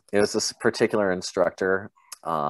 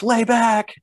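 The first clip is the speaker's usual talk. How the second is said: high, loud mock yell; trainees echoing the order; 5 dB louder than usual. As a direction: holler it back like a crowd of recruits, very high. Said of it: "everyone's like".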